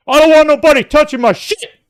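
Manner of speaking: high, loud mock yell stressing "shit"; mock angry shout. Shown as a demonstration. Showing what he didn't shout at the stargazing guide. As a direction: bark "I don't want nobody touching my shit" with a hard hit on "shit". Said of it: "with emphasis on that shit".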